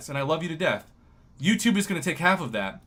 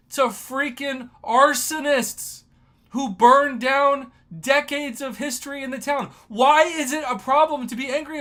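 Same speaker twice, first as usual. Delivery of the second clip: loud, higher indignant rant, slowing on the insult; righteous anger; 8 semitones higher, 6 dB louder than usual. Real, not acted. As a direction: shout your outrage at the arsonists. Ranting about arsonists burning hometown.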